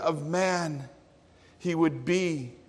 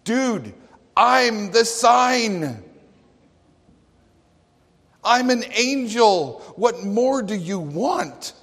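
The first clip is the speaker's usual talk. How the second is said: higher, louder, slow "dude, I'm the sign"; casual exasperated angel, higher and louder; 5 semitones higher, 4 dB louder, slower than usual. Acted. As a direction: play Gabriel as a fed-up buddy. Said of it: "And Gabriel is like".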